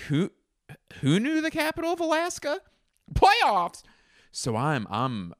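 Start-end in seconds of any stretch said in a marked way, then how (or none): 0.1-3.8 very high comic squeal of a question; mock incredulous. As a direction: jump way up in pitch, mock disbelief. Absurd mock outburst in a riff.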